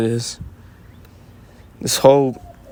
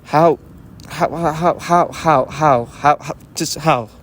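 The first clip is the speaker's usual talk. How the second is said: stuttering; 3 semitones higher, slower than usual. Real, not acted. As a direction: stammer on the word how. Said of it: "I'm stuttering".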